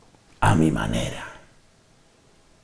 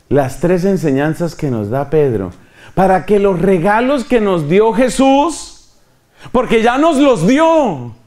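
ordinary talk, nothing marked

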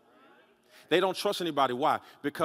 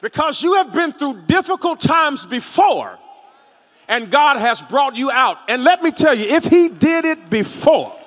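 full-force preaching; 10 semitones higher, 10 dB louder than usual. Real, not acted. Preacher urging the congregation to hold on, full-force preaching. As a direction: build each phrase into a ringing shout. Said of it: "don't y'all make me preach".

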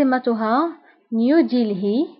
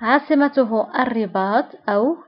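normal talking voice

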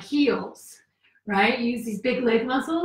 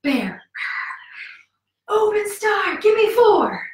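normal talking voice

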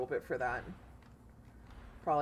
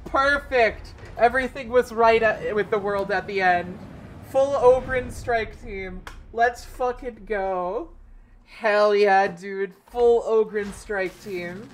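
loud, raised, drawn-out cheers; elated celebrating; 4 semitones higher, 9 dB louder than usual. Real, not acted. Gamer celebrating a perfect Ogryn strike team run, loud and elated. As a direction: exult loudly over the win.

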